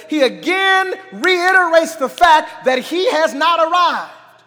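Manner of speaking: fervent preacher's emphasis; emphatic preaching. Real, not acted. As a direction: lift the voice to drive the point home. Preaching rises after reading verse.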